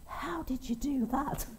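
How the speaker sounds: put-on high, slow child's voice of wonder; girl asking the dad in awe, high and slow; amazed little girl. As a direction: ask it like a wide-eyed little girl.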